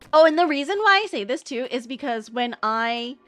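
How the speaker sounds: ordinary delivery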